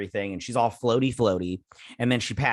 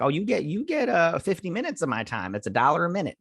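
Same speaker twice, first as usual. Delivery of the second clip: higher, teasing put-on voice, half joking; mock sweet offer; 6 semitones higher than usual. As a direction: pitch it up like a cheeky seller, playfully. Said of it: "I'd been like".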